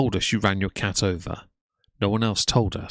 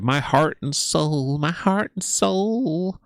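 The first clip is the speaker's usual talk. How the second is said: high, slow, whiny mock sob with a leaning heart; drawn-out fake-crying impression of Amber; mocking fake tears; 7 semitones higher, slower than usual. A commentator mocking Amber's fake tears, whiny and slow. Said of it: "trotting out the fake tears to go".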